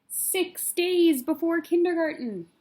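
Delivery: slow, high, loud chapter announcement; countdown heading. A narrator's reading. Reading a picture book to kids, announcing the day heading. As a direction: announce the day like a big title.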